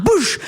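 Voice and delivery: whoosh sound. Acted. Helicopter dumping water image.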